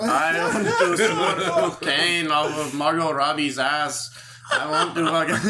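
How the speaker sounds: slow, laughing, hammed-up bragging voice; mock wannabe Wolf of Wall Street. Mocking the scam salesman's dream while laughing. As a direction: laugh through a put-on braggart's boast. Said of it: "I really want to be like the Wolf of Wall Street".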